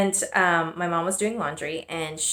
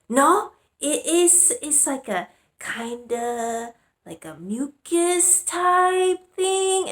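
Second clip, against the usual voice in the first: much higher and slow, halting mock-mom voice; imitating her mom; 8 semitones higher, much slower than usual. Mom explaining discharge to her daughter, slow high imitation. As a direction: play the mom groping for words, pitched high. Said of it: "And she's like".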